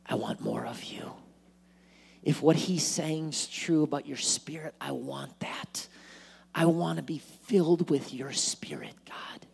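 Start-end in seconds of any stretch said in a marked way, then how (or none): 0.1-1.1 hushed, tender whisper to God; whispered prayer. Acted. Prayer at the altar. 4.8-9.5 hushed, tender whisper to God; whispered prayer. Acted. Prayer at the altar.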